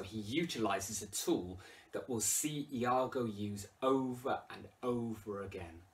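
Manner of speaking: ordinary talk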